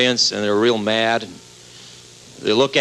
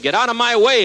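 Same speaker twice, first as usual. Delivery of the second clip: much higher, pushy eager shout; eager rush; 10 semitones higher than usual. Acted. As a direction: barge past to get to the water first. Wheelchair guy rushing forward.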